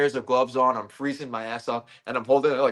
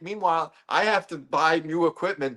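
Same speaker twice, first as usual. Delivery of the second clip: higher, heated outrage; worked-up rant; 9 semitones higher than usual. Real, not acted. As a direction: get fired up about wasted money. Rant about taxpayer money.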